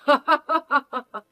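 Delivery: a high mocking chuckle; smug laugh. Mother-in-law laughing at the mother, smug high chuckle. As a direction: laugh like you've already won.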